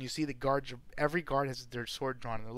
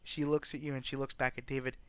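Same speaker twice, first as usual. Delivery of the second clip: ordinary talk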